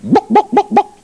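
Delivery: high squawky hen clucks; chicken clucking. Retold or imitated. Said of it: "chook wanders out and back in a few seconds later and goes".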